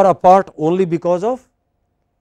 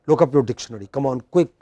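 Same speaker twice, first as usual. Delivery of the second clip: ordinary delivery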